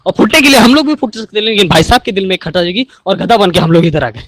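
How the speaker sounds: loud, fast comic rant to the officer; comic monologue. Acted. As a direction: deliver it like a stage comedy bit, loud. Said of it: "एक monologue है, वो बोल देता हूँ मैं".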